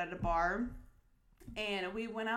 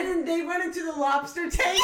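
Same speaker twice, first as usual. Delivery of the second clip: high, loud laughing shriek; shrieking laughter; 11 dB louder than usual. Real, not acted. Lobster tank crash, shrieking laughter.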